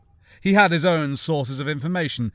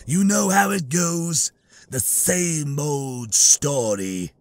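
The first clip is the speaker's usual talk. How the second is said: reptilian alien voice; much slower than usual. Acted. Trandoshan Bosk voiced in reading.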